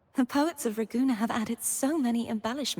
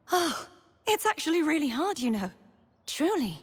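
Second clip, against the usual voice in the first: higher, lightly exasperated admission; candid complaint; 6 semitones higher than usual. Acted. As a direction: drop the solemn act and confide how hard it is. Drops the solemn act, admits difficulty.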